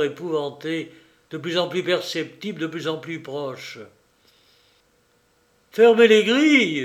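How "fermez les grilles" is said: panicked yell, much louder and higher; frantic shouted command. Acted. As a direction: scream the order across the yard. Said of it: "hurla Béchou".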